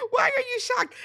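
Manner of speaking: high, incredulous, laughing through the words; can't believe she's shocked, laugh it out; laughing disbelief. Real, not acted. Host laughing at Giselle in disbelief, high incredulous squeal.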